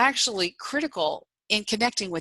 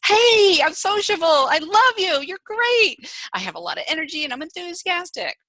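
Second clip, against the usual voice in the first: much higher, much louder gushing cheer; enthusiastic; 9 semitones higher, 5 dB louder than usual. Acted. Speaker acts out the initiating style, bubbly and loud. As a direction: burst out like a bubbly social type. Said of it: "Initiating is".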